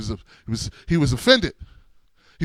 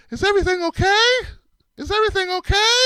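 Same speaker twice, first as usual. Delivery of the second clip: much higher, anxious rising lady voice; high fretful lady voice; 12 semitones higher than usual. Retold or imitated. Passing lady checking on the scene, a much higher anxious voice. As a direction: play a worried passer-by lady rushing over.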